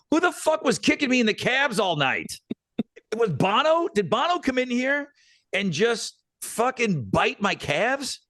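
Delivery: high-pitched, exaggerated complaining voice; joking to a friend about sore calves, high mock whine; mock outraged whine. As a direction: whine it up high like a cartoon victim.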